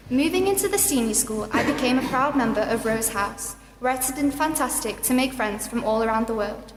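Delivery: usual speaking voice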